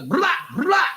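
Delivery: high, loud blah blah; mock her chatter dismissively; squeaky mock babble. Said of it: "She goes".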